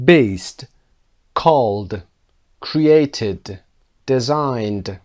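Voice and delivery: each past form said slowly and clearly, its ed ending spelled out; teacher reading word list. Shown as a demonstration. Teacher reads the verbs aloud for the class. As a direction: read each verb on its own with a falling close.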